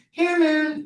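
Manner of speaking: very high singsong cat call; daughter calling her cat. Retold or imitated. Daughter calling cat across the house, high singsong. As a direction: call the cat in an imitated girlish high pitch. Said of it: "My daughter comes in and goes".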